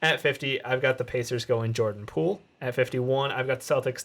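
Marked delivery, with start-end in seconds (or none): none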